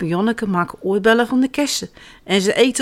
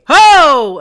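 big loud holler, pitched up; shouting; 6 semitones higher, 10 dB louder than usual. A narrator's reading. Children chasing birds from the orchard, shouting. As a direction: holler to chase the birds away. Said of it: "ze schreeuwen van".

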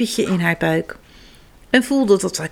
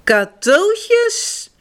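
excited question; 10 semitones higher than usual. Acted. Kas sees presents.

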